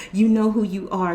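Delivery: normal talking voice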